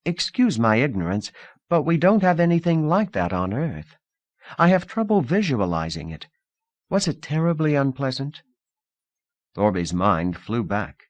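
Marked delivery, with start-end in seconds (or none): 0.0-8.4 a touch of careful hesitancy; hesitant. Acted. Lida asking Thorby about begging, mildly hesitant. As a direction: tread gently, unsure how to ask. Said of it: "She said hesitantly".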